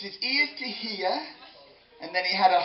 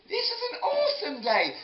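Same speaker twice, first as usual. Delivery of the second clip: excited exclamation; 11 semitones higher than usual. Acted. Storyteller marvelling at the day, high and excited.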